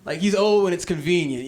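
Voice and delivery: ordinary talk, nothing marked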